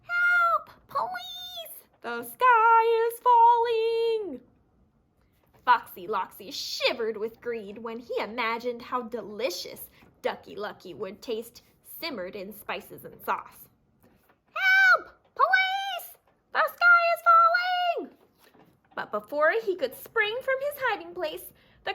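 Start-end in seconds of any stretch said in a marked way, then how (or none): 0.0-4.4 very high, loud, slow bird wail; panicked wail. Acted. Three birds calling for police, panicked high wail. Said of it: "the three birds wailed". 14.5-18.2 very high, loud bird wail; panicked wail. Acted. Birds calling for police, panicked high wail.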